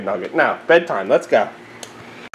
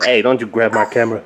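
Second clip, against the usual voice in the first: ordinary talk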